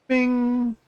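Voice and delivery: high, bright mouth-made chime effect; ding like the game just unlocked something; vocal bing sound. Pretend. Narrating his unlock to viewers with a high vocal chime.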